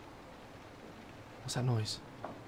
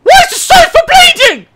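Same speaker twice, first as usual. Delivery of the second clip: very high, loud frightened yell; horrified and disbelieving; real panic; panicked shout; 18 dB louder than usual. Real, not acted. Shouting after the screams.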